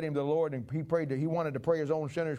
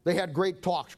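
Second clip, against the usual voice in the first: ordinary talk, nothing marked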